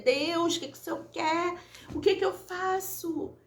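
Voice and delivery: high, crying plea to God; tearful prayer. Retold or imitated. Retelling her tearful prayer to God at night, high and pleading. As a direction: beg God for answers through tears. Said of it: "eu chorando, falei".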